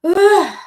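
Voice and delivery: loud, high "ugh"; exasperated groan. Real, not acted. Streamer fed up with Americans' attitude, loud groan. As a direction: groan out your frustration.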